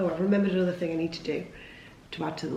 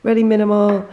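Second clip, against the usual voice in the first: mildly excited inner voice; 3 semitones higher, 7 dB louder than usual. Retold or imitated. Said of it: "and I was like".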